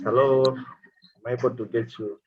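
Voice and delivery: ordinary delivery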